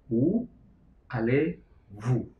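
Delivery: French question spelled out slowly, où then a long drawn allez-vous; slow each word out clearly for the class; slow French drill. Shown as a demonstration. Teacher slowing a French question for students. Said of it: "yaitu menjadi".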